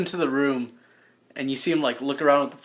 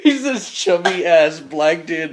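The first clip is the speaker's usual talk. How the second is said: normal talking voice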